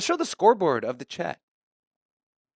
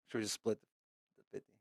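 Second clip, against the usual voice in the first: soft hushed question into the microphone; whispering into the mic; 7 semitones lower, 15 dB quieter, much faster than usual. Real, not acted. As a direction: drop to a whisper near the mic. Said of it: "Why are you whispering in the microphone".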